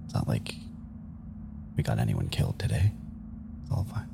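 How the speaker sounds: low, hushed, halting comfort; quiet reassurance. Acted. Kumari with a hand on Ward's shoulder, quiet reassurance. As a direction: murmur it gently, dropping away.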